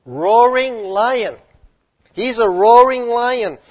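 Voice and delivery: loud, slow, booming roar of the words; preacher booming the lion's roar; roaring proclamation. Acted. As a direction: let it roar like a lion. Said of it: "heard a lion roar".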